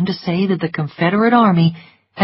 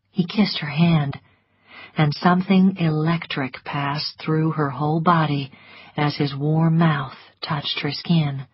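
plain reading voice

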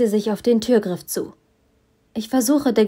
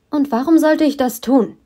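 defiant; 6 semitones higher, 5 dB louder than usual. A narrator's reading. Defiant question to villain.

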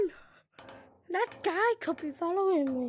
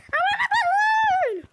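demanding shout; 9 dB louder than usual. Real, not acted. Player yelling at the seller for a balloon, demanding shout.

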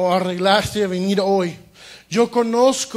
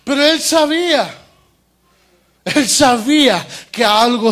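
raised, loud preaching voice; full-force preaching; 4 semitones higher, 4 dB louder than usual. Acted. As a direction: preach it out to the congregation. Predica a toda voz. (predicación a toda voz)

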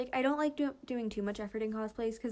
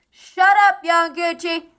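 very loud, higher yell through cupped hands; full shout; 7 semitones higher, 18 dB louder than usual. Acted. Suki shouting across a crowd to find Tadashi, full shout. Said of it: "Uses his hands on the microphone".

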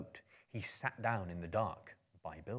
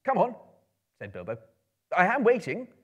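louder, higher, forced bravado; bold and cheerful; 10 semitones higher, 13 dB louder than usual. Acted. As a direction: sound brave though nervous. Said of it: "tried to sound bold and cheerful".

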